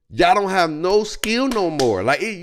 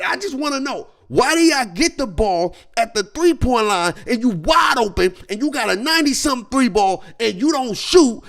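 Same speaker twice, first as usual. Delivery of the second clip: usual speaking voice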